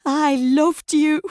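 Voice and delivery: high, loud and slow, losing control; crescendoing voice. A narrator's reading. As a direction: let the voice break open in grief and anger. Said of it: "Grace's voice has crescendoed".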